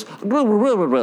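high-pitched Russian-sounding babble; mock Russian gibberish. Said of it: "like something in Russian".

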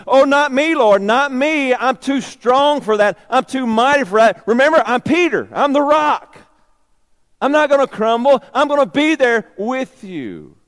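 loud, high, cocky bragging; boastful Peter. Acted. Peter boasting to the Lord, loud cocky bravado. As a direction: puff up with false confidence.

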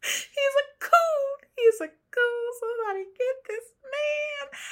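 very high, drawn-out cry; exasperated wail. Real, not acted. Exasperated outburst after laughing.

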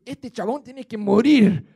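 hammed-up angry king demanding death; mock angry verdict. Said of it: "David se enoja y dice". (rey indignado, más lento y fuerte)

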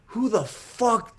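high, drawn-out wrestler outrage; Roddy Piper. Retold or imitated. Hired wrestler going off on his employer, incredulous promo voice. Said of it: "he's just going off".

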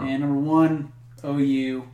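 building announcer reveal; top ten countdown. Acted. Countdown reveal, number one.

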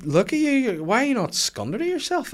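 high-pitched, indignant mimicked telling-off; imitated scolding. Retold scolding after catching him.

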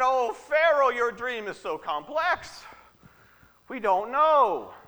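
high, loud mock-helpless courtiers; hammed-up confession of ignorance, slowing at the end; pleading magicians.

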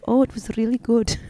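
put-on Icelandic accent. Guy answering how Iceland was, put-on Icelandic accent. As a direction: imitate an affected Icelandic accent. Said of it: "lived in Iceland for a while".

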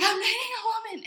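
high, loud retold outburst; blurted confession. Telling her sister about dating a woman, blurted confession. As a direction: blurt the secret out, high-pitched.

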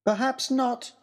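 a hint of a clever girl's voice; disguised girl answering the villain; lightly voiced Harry.

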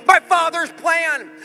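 high, loud, impassioned sermon delivery; full-force preaching. Real, not acted. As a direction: preach it at the top of your voice, drawing out the big words.

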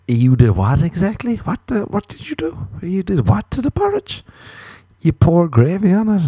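high, disbelieving questions rising at the end; incredulous questioning. Acted. Partner reacting to gravy poured on the porridge, incredulous. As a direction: act out baffled disbelief, pitch climbing.

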